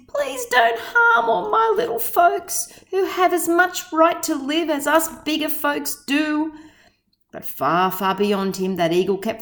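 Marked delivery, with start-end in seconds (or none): 0.1-6.5 higher, pleading plea; begging. A narrator's reading. Horton chasing the eagle, higher pleading beg. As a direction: beg for your little friends, pitched up. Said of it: "and begged".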